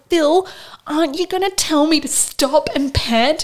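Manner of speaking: high, loud, desperate plea; pleading call. Retold or imitated. Labouring mother begging Phil to let her pant, loud high plea. Begging midwife mid-birth.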